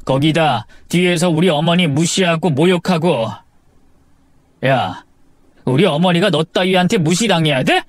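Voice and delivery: indignant, pitch climbing as it builds; accusing, heated husband; very angry. Acted. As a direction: confront your wife, voice rising to a peak. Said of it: "화가 잔뜩 난 제 모습".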